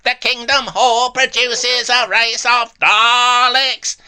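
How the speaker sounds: slow, high, loud robotic villain chant; Dalek. Acted. Host mocking the caller as a robot alien, loud robotic chant. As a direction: drag out each word in a high, mechanical shout. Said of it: "you sound like a Dalek".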